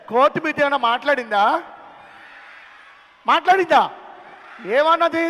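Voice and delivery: usual speaking voice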